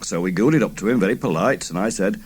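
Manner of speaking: ordinary delivery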